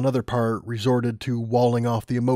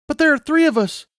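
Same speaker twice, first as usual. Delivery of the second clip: put-on much higher, louder girl's voice; young Lumina protesting; 5 dB louder than usual. Lumina protesting to the Griffin, high young voice. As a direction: object with alarm.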